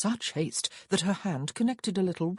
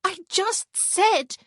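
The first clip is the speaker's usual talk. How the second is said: whiny protest; 7 dB louder, much slower than usual. A narrator's reading. Mercy protests, cut off.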